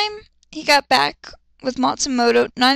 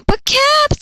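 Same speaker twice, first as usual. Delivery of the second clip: very high, loud whine; 6 dB louder than usual. Acted. Matsumoto whining to her captain, high loud whine. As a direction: wail it and break off.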